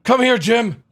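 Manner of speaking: loud high yell; shouting. Acted. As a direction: call him over angrily. Yelling at a game character.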